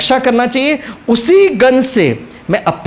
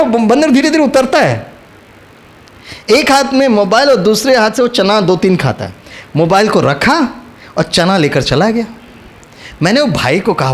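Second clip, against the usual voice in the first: ordinary delivery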